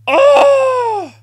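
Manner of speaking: very high, loud shriek of shock. Real, not acted. Fan shrieking at a dramatic moment. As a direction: let out a sudden piercing cry.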